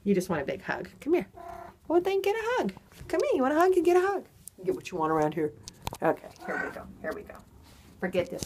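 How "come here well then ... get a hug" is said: high, playful cooing to a cat; doting pet talk. Real, not acted. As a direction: coo a hug offer up high. Offering the cat a hug.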